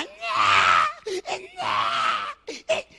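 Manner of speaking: gruñido grave y ronco imitando el motor; imitando un quejido de motor. Retold or imitated. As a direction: imita el ruido del carro, grave. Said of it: "ella siempre a la hora que yo andaba". (ruido de motor imitado, grave)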